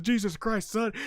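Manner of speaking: imitated wrestler's retort, pitched high; Stone Cold. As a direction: fire back like a wrestler in a promo. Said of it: "And Stone Cold says".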